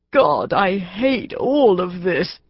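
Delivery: slow, drawn-out wail of misery. A narrator's reading. Benjamin wailing to Kick over the phone, slow and miserable. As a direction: moan it out like he is at the end. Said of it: "Benjamin wailed".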